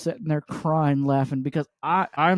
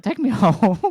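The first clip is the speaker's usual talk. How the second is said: laughing plea; 5 semitones higher, 6 dB louder than usual. Retold or imitated. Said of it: "I was like".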